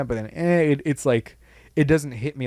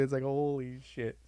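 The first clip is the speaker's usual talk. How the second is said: ordinary talk, nothing marked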